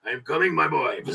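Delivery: lightly voiced pirate in a muddled put-on accent; a hammy, badly imitated accent; Hondo. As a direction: play the rescuer with a shaky-accurate foreign accent. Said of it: "Hondo hears it and is like".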